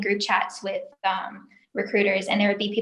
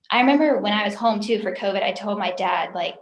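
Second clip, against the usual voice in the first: ordinary talk, nothing marked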